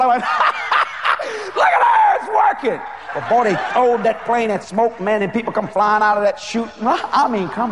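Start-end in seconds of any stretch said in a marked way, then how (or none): 0.3-1.5 laughing shriek, very high; high shouted laugh. Real, not acted. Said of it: "I didn't mean to shout". 1.6-2.8 very high, loud gleeful cry; gleeful shout. Retold or imitated. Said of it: "I didn't mean to shout".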